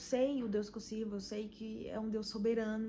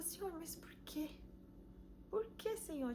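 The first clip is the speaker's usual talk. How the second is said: mild pleading prayer; 7 semitones higher, 9 dB quieter than usual. Retold or imitated. Retold pleading prayer to God.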